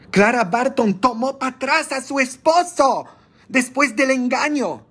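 high, mocking gossip tone. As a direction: sneer it like a gossiping neighbour. Mocking gossip about Clara.

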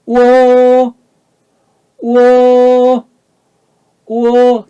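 우오 held loud, high and level; high first tone. Shown as a demonstration. Teacher leading students in unison, 우오 high and level. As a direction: sustain 우오 on one high note. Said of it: "발음해 봅니다".

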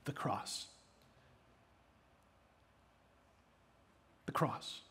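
almost whispered, low and soft; hushed reveal. Real, not acted. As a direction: let it land in a hush. Preacher reveals key answer.

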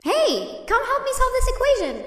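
high, fast urgent call for help. Acted. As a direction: blurt it out in a rush. Robot calls out for help.

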